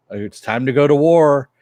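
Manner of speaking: loud high declaration; calling out. Retold or imitated. David calling Lenny to war, loud shout. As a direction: shout it across the crowded house.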